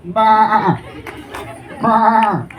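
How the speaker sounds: imitated goat bleating. Comedian playing the goat asking, imitated bleating. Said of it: "तो बकरा उसको कह रहा है".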